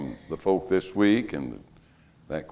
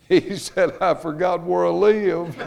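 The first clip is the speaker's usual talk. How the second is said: laughing through the punchline; 8 semitones higher, slower than usual. Retold or imitated. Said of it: "old man answers policeman".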